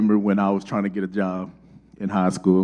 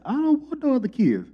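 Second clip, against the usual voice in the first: stubborn refusal. Retold or imitated. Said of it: "I said".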